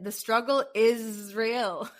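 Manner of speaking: slow, laughing through the words; wry laugh at herself. Real, not acted. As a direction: chuckle it out slowly. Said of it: "as I like to say".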